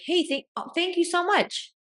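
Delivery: high-pitched gushing mock thanks; imagined grateful reply. Imagining the woman thanking her for the compliment, gushing. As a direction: imitate a delighted thank-you, voice way up. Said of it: "what I would have loved would have been like".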